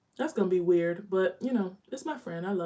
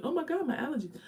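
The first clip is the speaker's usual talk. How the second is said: 1 mock excuse; 10 semitones higher, 4 dB quieter, faster than usual. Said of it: "I'll be like".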